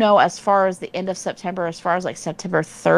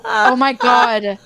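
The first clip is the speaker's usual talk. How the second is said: laughing; 4 semitones higher, 3 dB louder than usual. Real, not acted. Laughing panic about the bug.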